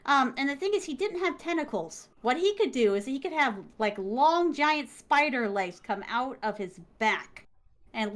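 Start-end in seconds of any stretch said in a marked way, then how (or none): none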